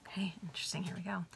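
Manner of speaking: soft whisper to herself; breathless little whispered note; whispered aside. Real, not acted. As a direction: hush it as an aside. Whispered aside before reading on.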